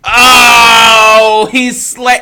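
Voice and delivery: a high, loud, half-laughing cry of surprise; delighted shout. Real, not acted. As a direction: break into a loud, high yelp at the punchline. Host reacts to the pun punchline.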